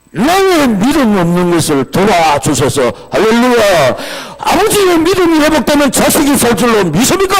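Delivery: high, loud rallying cry; full-force preaching. Real, not acted. Preacher rousing parents about restored faith, shouting fervently. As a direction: shout it, climbing to a fervent question.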